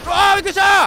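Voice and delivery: real jump-scare shout, high and loud; startled yell. Real, not acted. Jump-scared by a toy's sudden voice, startled yell. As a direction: yelp as something pops out at you.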